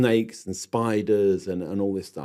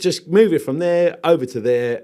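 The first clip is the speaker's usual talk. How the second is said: loud, higher, brushing it off; dismissive Aussie boss; 6 semitones higher, 5 dB louder than usual. Retold or imitated. Landscaping boss laughs off the gloves request, loud and dismissive. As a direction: wave away the worry with a hearty nah nah mate. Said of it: "And the guy".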